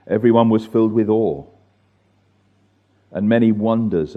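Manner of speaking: slow, measured scripture reading with long pauses. Real, not acted. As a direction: read it out slowly, phrase by phrase. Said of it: "let me read it slowly".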